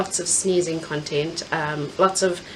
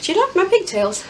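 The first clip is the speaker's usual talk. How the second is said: playful; 12 semitones higher, 4 dB louder than usual. Real, not acted. Playful question to viewers.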